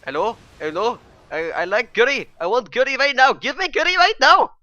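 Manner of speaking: put-on high, loud caricatured Pakistani accent; rough Pakistani accent, building to a high shout; attempted Pakistani accent. Imagining a TV presenter's mockery, in a bad Pakistani accent. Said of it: "Let's try and do a Pakistani accent".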